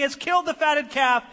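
high, loud news announcement; excited servant. Acted. Servant telling older son about the feast, excited. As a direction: proclaim it loudly, high-pitched.

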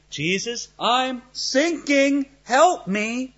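drawn-out mock cry for help; slow-motion sinking plea. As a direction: stretch the words as if slowly going under. Acts out Peter sinking as in quicksand.

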